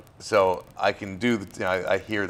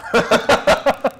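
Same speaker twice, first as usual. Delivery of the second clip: a sudden high bright laugh; loud happy laugh; high laugh burst; 9 dB louder than usual. Real, not acted. Laughs after naming favourite actors.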